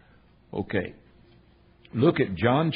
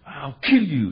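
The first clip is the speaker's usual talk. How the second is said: louder, higher mock yell; brief raised-voice threat; retold threat; 4 semitones higher, 6 dB louder, slower than usual. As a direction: quote an attacker's threat, punched up loud. Retold threat from angry man.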